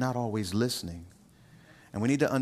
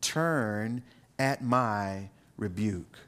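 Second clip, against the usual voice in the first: drawn out, very slow, lower; slow solemn reading; 4 semitones lower, much slower than usual. Shown as a demonstration. Preacher reading the verse to the congregation, slow and weighty. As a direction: linger on each word of the verse. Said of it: "notice what she says in verse twenty-three".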